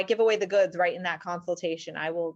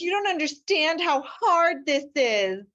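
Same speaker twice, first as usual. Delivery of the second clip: higher-pitched wail, without real sobs; mock crying; 6 semitones higher than usual. Wife bawling at attorney's staff, mock crying complaint. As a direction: imitate a weepy complaint.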